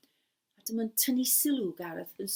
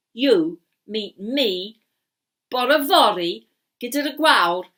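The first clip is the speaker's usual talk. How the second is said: commanding wizard; 12 dB louder, much slower than usual. Acted.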